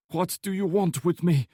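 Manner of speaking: high, loud, close to losing it; cornered woman lashing out in fear; panicked demand. A narrator's reading. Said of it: "She was on the verge of losing it".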